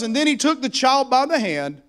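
usual speaking voice

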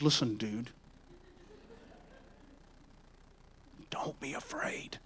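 low aside dropping into a whisper; hushed, firm word to a frightened man; Jesus, intimate urging. Acted. Jesus pulling Jairus close by the robe, hushed urging. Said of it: "That's my version of it".

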